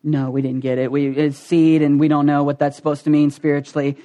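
low, subdued admission of confusion; low-pitched puzzled mumbling student; clueless disciples. Retold or imitated. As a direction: own up that you're lost, low in pitch. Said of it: "you can just see 'em going".

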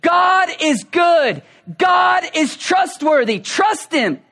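high, slow shout of God is good; creation screaming. Pretend. Preacher voicing creation shouting God is good, loud and high. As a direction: cry it out as the whole world proclaiming. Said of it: "everything around them was screaming".

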